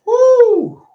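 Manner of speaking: loud, high shaken whoop. Real, not acted. A dad about to show the fight lets out a loud high whoop. Reacting before showing video.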